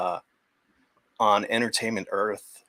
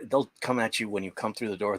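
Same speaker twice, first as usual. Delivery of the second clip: usual speaking voice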